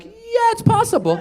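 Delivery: falsetto shrug. Retold or imitated. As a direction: say it like it's totally believable. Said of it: "All of us were like".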